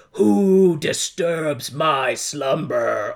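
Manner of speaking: slow booming stone-statue voice; grinding stone statue. Pretend. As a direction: rumble it out like a waking idol. Giant head, wrong movie joke.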